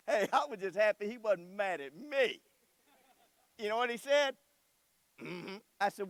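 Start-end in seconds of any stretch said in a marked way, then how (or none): 5.2-5.6 closed-mouth sulky grunt. Retold or imitated. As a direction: grunt it like a sulking boy. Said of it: "He's just grunting at me".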